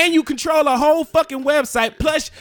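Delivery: heated family shouting. Real, not acted. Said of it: "Stop yelling at me".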